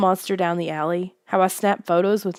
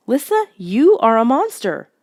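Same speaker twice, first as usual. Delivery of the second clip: louder, higher accusation; accusing; 6 semitones higher, 5 dB louder than usual. A narrator's reading. Boy accusing Lissa, louder and higher. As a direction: throw the accusation at her.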